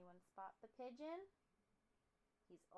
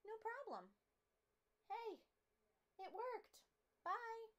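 excited; 12 semitones higher, 6 dB louder, slower than usual. Acted. Birds agree and leave.

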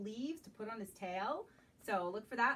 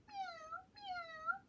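very high, rising meows; high cat meow; 4 dB quieter than usual. Acted. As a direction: meow like a kitty. Cat voice in picture book.

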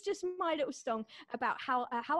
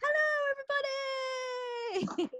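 much higher, ringing hello to everybody; 12 semitones higher, slower than usual. Real, not acted. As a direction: call out to the room, bright and big. Second comic greets audience.

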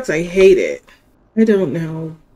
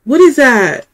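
higher and louder, alarmed; startled question; 7 semitones higher, 9 dB louder than usual. Real, not acted. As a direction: react as the monster appears. Sees a big cat monster.

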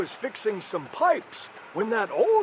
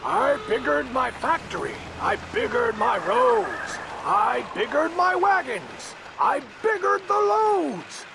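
rising higher each line; building boast; 7 semitones higher than usual. Acted. Onceler boasting of his growing business. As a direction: climb with each bigger.

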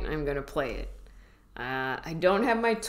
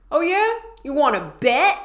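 high, loud playground dare; sassy schoolyard challenge, up high and loud; nineties kid; 10 semitones higher, 9 dB louder than usual. Shown as a demonstration. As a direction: taunt it like a cocky nineties kid. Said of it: "Say it like that nineties kid".

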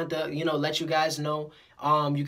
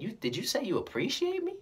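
high, softer, incredulous question; imitated amazement; 6 semitones higher, 5 dB quieter than usual. Customer hearing he is appreciated, amused disbelief. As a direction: act out disbelief, close to laughing.

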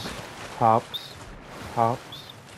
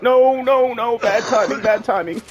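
higher, lightly acted no no bad timing; retold panic; 9 semitones higher than usual. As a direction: replay the panicked outburst. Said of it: "and I was like".

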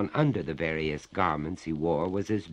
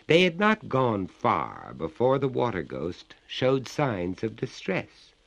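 ordinary delivery, nothing marked

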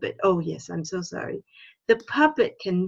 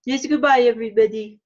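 put-on higher, louder cheery goodbye in a French accent; the fox puppet waving off the class, higher French accent; 4 semitones higher, 6 dB louder, faster than usual. The puppet fox says goodbye to the listeners, higher French accent. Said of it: "thank you, Mister Renard".

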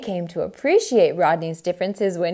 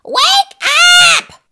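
high, loud bossy yell; piercing wake-up call; 11 dB louder than usual. Acted. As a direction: yell it to rouse a sleeper. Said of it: "she yelled".

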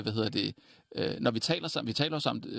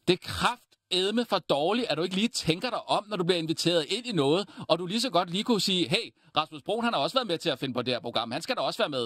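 high, raised voice in role-played scolding; telling-off voice; 7 semitones higher than usual. Telling Frederik off on request, high hard scolding. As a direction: scold as if calling out a warning. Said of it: "prøv lige med den stemme nu".

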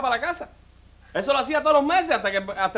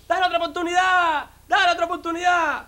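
crowd yelling; 7 semitones higher than usual. Acted. Whole family begs the executioner for mercy, yelling high. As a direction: yell it like a whole family begging. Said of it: "empezaron a gritarle al verdugo".

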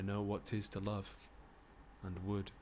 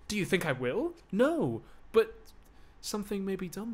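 higher, louder indignant protest; indignant denial; 8 semitones higher, 7 dB louder than usual. Acted. Lover denying she'll be tempted, indignant. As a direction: fling back the accusation.

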